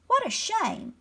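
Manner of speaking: high-pitched show of pity; pretended sympathy. A narrator's reading. Mole goads the dragon to cry, feigning sympathy. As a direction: lay on the mock sympathy. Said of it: "the mole pretended surprise".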